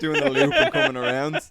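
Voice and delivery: high, loud laughing words. Real, not acted. Picturing the CCTV footage, laughing hard. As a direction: laugh through the line.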